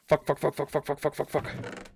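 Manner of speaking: rapid, even staccato run of curses; real flustered muttering in a game mishap; panicked swearing. Real, not acted. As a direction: fret through a string of quick curses, fading away. Panics over gate left open.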